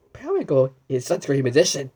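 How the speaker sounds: ordinary delivery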